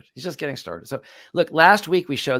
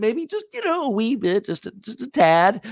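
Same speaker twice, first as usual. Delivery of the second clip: high mocking "a wee bit"; sarcastic; 6 semitones higher than usual. Real, not acted. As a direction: squeeze it out sarcastically, pitch way up. Sarcastic aside after quote.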